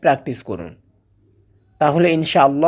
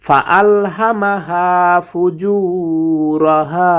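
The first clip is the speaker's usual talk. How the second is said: Quran recitation; 2 semitones higher, 3 dB louder, much slower than usual. Acted. Teacher reciting the full passage after the lesson. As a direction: chant each verse with long drawn vowels.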